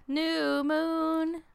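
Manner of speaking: held, high sing-song echo; playful intoning. Pretend. Co-host echoing a new moon theory, playful intoning. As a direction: intone it high on long, level notes.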